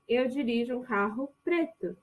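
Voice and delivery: normal talking voice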